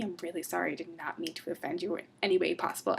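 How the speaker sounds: usual speaking voice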